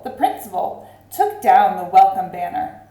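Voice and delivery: ordinary delivery, nothing marked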